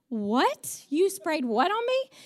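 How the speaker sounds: high, rising incredulous question; mock-horrified, pitch climbing at the end; shocked disbelief. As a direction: replay your appalled double take. Retells shocked reaction to urine spray.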